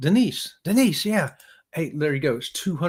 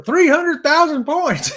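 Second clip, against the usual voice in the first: laughing; 11 semitones higher, 5 dB louder, slower than usual. Real, not acted. Joking about Kenny's huge number.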